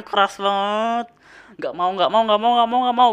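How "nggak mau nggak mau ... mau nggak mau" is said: retold protest. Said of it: "Gua, gua gitu-gitu terus".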